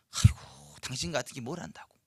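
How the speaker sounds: imitated scoffing, opening on a sighed exclamation; mock sneering. Church member scoffing at someone who volunteers, imitated sneer. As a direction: belittle them under your breath. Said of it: "하면서 비웃고요".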